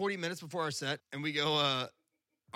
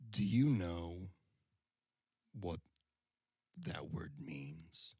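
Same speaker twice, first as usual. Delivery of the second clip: very slow and much softer, long pauses between words; slow menacing question; 9 semitones lower, 8 dB quieter, much slower than usual. Retold or imitated. The stranger staring him down at the merch table, slow hushed menace. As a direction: draw it out like a quiet threat.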